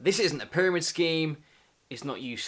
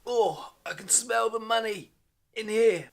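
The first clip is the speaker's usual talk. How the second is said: much higher, hammed-up greed; greedy delight; 8 semitones higher than usual. Sniffing the room for money, mock greedy. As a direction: savour the smell of money.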